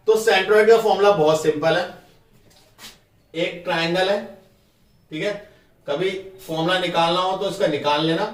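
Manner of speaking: ordinary delivery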